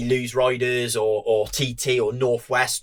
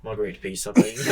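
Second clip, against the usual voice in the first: laughing retold order; 3 semitones lower than usual. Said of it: "Waiter comes round".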